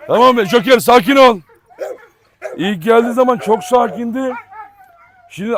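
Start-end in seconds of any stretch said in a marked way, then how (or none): 0.1-1.4 louder, higher call to settle him; calming the dog. Real, not acted. Owner hushing barking Joker, raised firm call.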